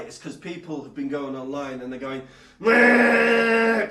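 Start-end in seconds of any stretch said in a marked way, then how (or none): 2.6-3.9 loud, high sustained mock modem noise; imitated dial-up screech. People going online on dial-up, imitated modem screech. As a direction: hold one high electronic whine. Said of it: "they're using dial-up internet connection".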